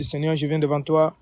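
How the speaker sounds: ordinary delivery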